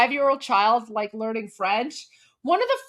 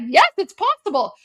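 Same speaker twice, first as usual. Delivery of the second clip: cheerful pineapple; 7 semitones higher, 6 dB louder than usual. Retold or imitated. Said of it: "He's like".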